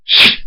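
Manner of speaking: loud hissed whoosh of a blade; stabbing sound effect. Retold or imitated. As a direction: voice the knife strike.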